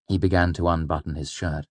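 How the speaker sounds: plain reading voice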